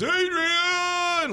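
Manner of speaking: lightly voiced Rocky calling out; imitating Rocky's call. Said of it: "when he says".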